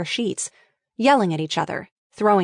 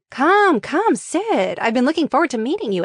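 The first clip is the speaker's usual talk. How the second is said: high, bright-eyed welcome, quick on the last line; eager welcome; 5 semitones higher than usual. Acted. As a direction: beckon her over with excitement. Lily eagerly welcomes Asa.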